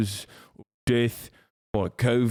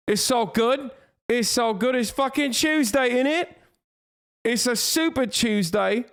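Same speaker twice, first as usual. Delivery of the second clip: much higher giddy squeal; giddy hype; 11 semitones higher than usual. Pretend. Streamer with grog in him hyping Tuesday, much higher and giddy. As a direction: squeal it like you're tipsy and thrilled.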